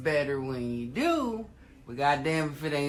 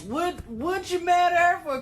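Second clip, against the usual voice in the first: indignant disbelief; 12 semitones higher, 5 dB louder than usual. Real, not acted. Indignant rhetorical question.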